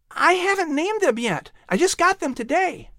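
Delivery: put-on high, eager little boy pleading; a hopeful child asking his mother; boy begging to keep his pets; young boy voice. Patrick answers courier.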